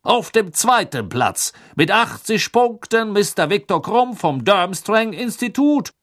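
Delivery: high, loud booming announcer; magically amplified voice. A narrator's reading. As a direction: announce to the whole stadium. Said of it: "seine magisch verstärkte Stimme hallte".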